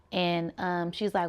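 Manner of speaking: ordinary talk, nothing marked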